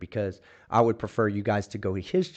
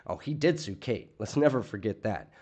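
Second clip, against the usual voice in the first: usual speaking voice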